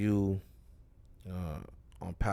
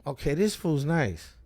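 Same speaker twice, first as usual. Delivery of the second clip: slow, higher-pitched dawning admiration; re-enacted loud, impressed reaction; impressed approval; 6 semitones higher, 5 dB louder, slower than usual. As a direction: savor each verdict, won over. Re-enacting being impressed by a rapper.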